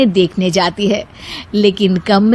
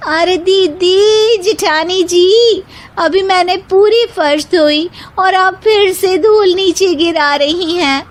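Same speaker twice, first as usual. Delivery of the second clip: complaining bahu; 9 semitones higher than usual. A narrator's reading. Youngest bahu complains about dust on her washed floor, high whine. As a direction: whine at her sisters-in-law.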